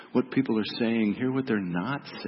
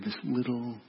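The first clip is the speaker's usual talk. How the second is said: hushed; 7 dB quieter than usual. Acted. Evoking a tiny hidden water sound.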